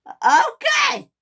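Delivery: high, exasperated shriek; screamed. A narrator's reading. Millie snapping at Steven's calm down, screaming. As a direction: shriek it back sharply. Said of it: "she screamed".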